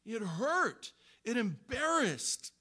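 slow, stretched words, voice cracking into a whisper; pained emphasis. Real, not acted. Preacher to congregation, pained and stretched. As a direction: ache through every word. Pained recounting of being rebuked.

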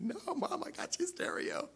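very high, squeaky choked-up reply to mom; mock-tearful reassurance. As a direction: imitate choking up as you tell mom. Reassuring weeping mother, voice squeaks.

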